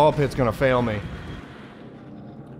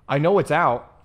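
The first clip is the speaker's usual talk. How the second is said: normal talking voice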